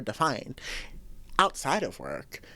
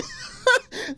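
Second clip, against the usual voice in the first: high giggle; 4 dB louder than usual. Real, not acted. Laughing at her own story.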